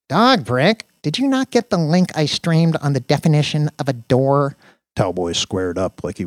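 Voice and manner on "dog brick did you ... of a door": higher, louder nerdy scolding; irritated Danny. Acted. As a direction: tick off the intruding coworker.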